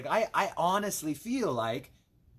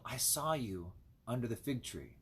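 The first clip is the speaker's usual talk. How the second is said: soft and much quieter, gentle and lower; tender Jesus; 4 semitones lower, 8 dB quieter than usual. Acted. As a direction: say it gently, like a loving reassurance. Voicing Jesus, tender quote.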